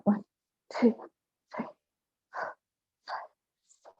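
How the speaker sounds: panting between counts; out of breath from the workout; heavy breathing. Real, not acted. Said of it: "Sorry for the heavy breathing, guys".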